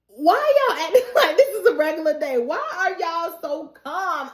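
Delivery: high, fast disbelief, then slowing; can't believe how calm they are; rising incredulous rant. Real, not acted. Viewer disbelief at calm characters.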